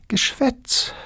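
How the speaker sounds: very high, teasing brush-off, rising at the end; a high mocking dismissal of his vows; dismissive girl. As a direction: tease him off lightly. Girl dismisses suitor again.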